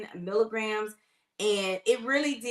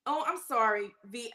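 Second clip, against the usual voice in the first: ordinary talk, nothing marked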